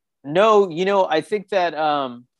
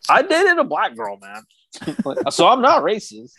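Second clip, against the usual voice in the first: much higher, put-on smug dude voice; mock a bro showing off his politics, pitched way up; mock woke hipster; 6 semitones higher than usual. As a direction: parody of a self-righteous hipster boasting. Mocking performative liberal hipster.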